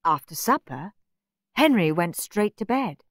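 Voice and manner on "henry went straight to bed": ordinary delivery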